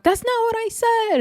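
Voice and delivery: very high-pitched imitated yelling; mock yell. Russian Cream standing up to protest, high-pitched mock yell. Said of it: "Don't yell at me".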